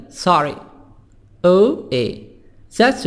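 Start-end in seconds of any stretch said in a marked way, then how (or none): 0.1-0.6 American "sorry" with an open AH; American accent. Shown as a demonstration. Teacher contrasting accents, American "sorry" with AH. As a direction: open the O to "sah-ree", American style.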